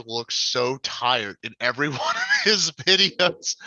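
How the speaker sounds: breaking into a high laugh mid-sentence. Real, not acted. Teasing a tired fellow YouTuber to friends, laughing. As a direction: laugh through the words, pitch climbing. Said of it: "I'm just laughing".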